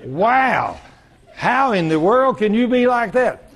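high, slow acted astonishment; amazed stranger. Store customer amazed by the preacher's answer, acted astonishment. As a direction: marvel at it, stretching the words.

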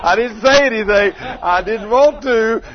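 laughing. Real, not acted. Preacher telling the congregation, laughing loud. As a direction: let it spill out with laughter. Laughing through his story.

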